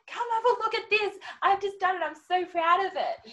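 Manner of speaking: high, loud, proud exclamation; excited showing-off. Retold or imitated. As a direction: voice a delighted client showing off the room. Retold client proudly showing off room.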